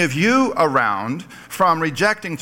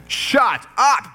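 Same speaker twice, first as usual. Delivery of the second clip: shouted rebuke; 9 semitones higher than usual. Acted. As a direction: bark it at him to be silent. Criminal rebukes the mocking criminal.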